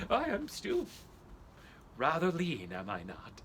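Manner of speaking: ordinary talk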